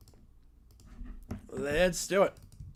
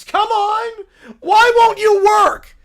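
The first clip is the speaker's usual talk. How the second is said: high, loud, exasperated cub; little bear losing patience; frustrated bear shout; 12 semitones higher, 14 dB louder, slower than usual. Acted. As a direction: yell at the fire that won't light.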